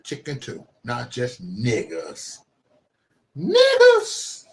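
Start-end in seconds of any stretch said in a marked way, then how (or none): 3.4-4.5 very high, loud yell; screamed exclamation. Acted. Streamer yelling the word for shock. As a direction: scream it out in a strained falsetto.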